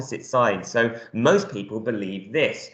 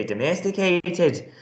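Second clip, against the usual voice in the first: Irish accent, pitched higher; 6 semitones higher, slower than usual. Shown as a demonstration. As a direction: give the word an Irish lilt. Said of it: "That's an Irish accent".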